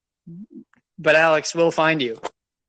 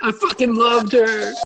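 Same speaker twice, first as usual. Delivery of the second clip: higher, slow hammed-up lament; mock heartbreak; 5 semitones higher than usual. Playing along with the breakup meltdown, mock heartbroken. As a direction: draw it out in mock anguish.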